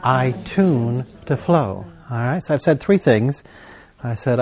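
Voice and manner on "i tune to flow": slow, drawn-out words for joining in; leading group recitation. Shown as a demonstration. Preacher leads the congregation in saying it together, slow recitation. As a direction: lead them through it very slowly. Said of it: "Let's say it together".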